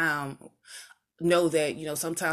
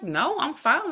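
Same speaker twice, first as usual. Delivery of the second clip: high, airy brush-off; breezy dismissal; 9 semitones higher, slower than usual. Retold or imitated. To worried friends, a high breezy brush-off. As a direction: wave off their worry, high and light. Her own dismissive reply retold.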